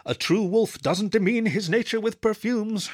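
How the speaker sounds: weary groan rising to a high, drawn-out recital; exasperated, pitch climbing as it goes. A narrator's reading. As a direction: groan out a rule you are sick of. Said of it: "I groaned".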